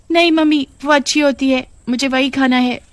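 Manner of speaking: put-on high childish pleading voice; a little girl whining for a treat; young daughter voice. Daughter begs her mother at the shop, high and childish.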